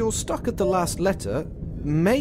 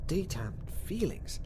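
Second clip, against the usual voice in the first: hushed whisper to self; whispered throwaway joke; 10 dB quieter than usual. Real, not acted. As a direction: breathe it as a private aside. Player aside to self.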